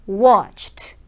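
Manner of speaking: watched whispered, ending in a t sound; teacher whispering the t ending. Shown as a demonstration. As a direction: breathe out watched with a crisp t. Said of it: "Imagina um T ali".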